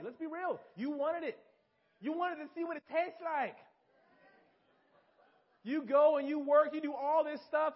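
normal talking voice

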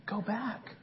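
God gently calling Elijah back. Acted. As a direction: speak close, urging him gently. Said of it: "And you continue reading the story".

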